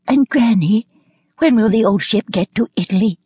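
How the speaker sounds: put-on high-pitched child's voice; little girl's question. A narrator's reading. Irma asks her grandmother about the ship, little-girl voice. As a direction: ask it high, as a curious child would. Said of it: "asked Irma".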